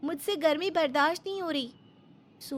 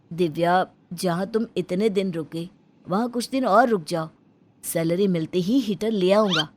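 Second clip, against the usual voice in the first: put-on lower, firm young husband voice; husband's voice; 9 semitones lower, 4 dB louder than usual. Husband refusing his wife a heater until payday, lower. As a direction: lower and steady, a husband putting his foot down.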